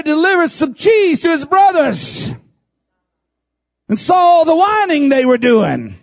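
high, loud, impassioned preacher's cry; full-force preaching. Acted. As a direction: preach it out to the congregation with fire. Preaching David story at full force.

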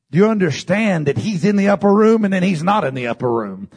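ordinary talk, nothing marked

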